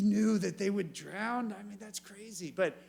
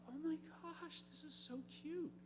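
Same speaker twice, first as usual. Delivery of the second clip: high soft cooing voice; 9 semitones higher, 14 dB quieter than usual. Retold or imitated. Preacher recalling cooing at piglets, high and soft. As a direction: coo over the cute piglets. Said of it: "I was like".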